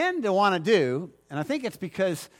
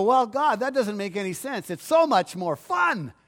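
complacent believer; 5 semitones higher, 4 dB louder than usual. Retold or imitated. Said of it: "And we go".

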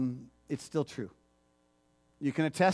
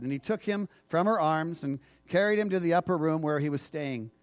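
ordinary talk

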